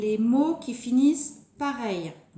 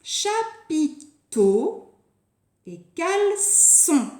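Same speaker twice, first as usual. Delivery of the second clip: higher, slow CHA-PI-TEAU, CAL-EÇON; syllable by syllable; 5 semitones higher, 4 dB louder, much slower than usual. Shown as a demonstration. Teacher setting pupils new words to compare, syllable by syllable. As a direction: separate each syllable of Chapiteau and caleçon with pauses.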